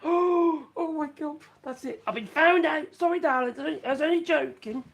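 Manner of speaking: gasping, high mock alarm at being caught out; sudden high, loud fake fright, then an apology; mock panic. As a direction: ham up being caught by the wife. Mock panic at wife overhearing.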